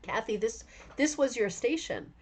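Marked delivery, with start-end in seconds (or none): none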